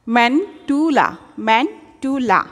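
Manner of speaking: ম্যান্টুলা drawn out slowly, each syllable clear; slow pronunciation. Shown as a demonstration. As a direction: say ম্যান্টুলা slowly for students to copy. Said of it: "এইভাবে উচ্চারণ করে করে পড়লে".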